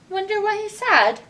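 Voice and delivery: usual speaking voice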